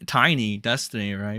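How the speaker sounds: ordinary delivery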